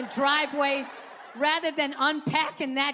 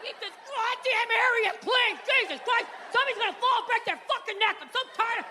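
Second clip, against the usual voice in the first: higher, mock angry rant at the household; mad guy rant; 6 semitones higher than usual. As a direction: play the fed-up husband yelling about the mess. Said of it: "You can be mad".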